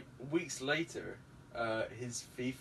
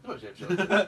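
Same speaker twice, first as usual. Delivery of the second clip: indignant retort; 7 semitones higher, 10 dB louder than usual. Retold or imitated. Acted doorstep quote, louder.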